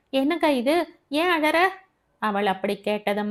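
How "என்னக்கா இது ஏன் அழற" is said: high, flustered questioning; alarmed. A narrator's reading. Devi shakes crying Abi awake, high alarmed questions. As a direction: ask in alarm, pitched well up. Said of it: "பதறிப்போன Devi".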